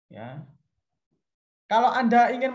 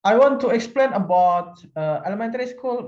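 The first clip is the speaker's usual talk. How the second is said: abrupt opener; 3 semitones higher, 5 dB louder than usual. Shown as a demonstration. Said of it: "jangan seperti itu ya".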